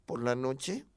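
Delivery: ordinary delivery, nothing marked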